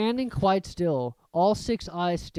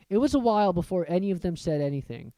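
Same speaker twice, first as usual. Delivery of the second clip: ordinary delivery, nothing marked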